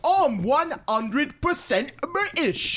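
high, slow mock Cockney accent; bad movie Cockney accent, high and drawn out. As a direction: do a hammed-up fake Cockney chimney sweep.

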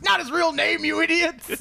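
high, hammed-up retort; acted film character. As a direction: quote the character in a high, exasperated put-on voice. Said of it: "fucking John goes".

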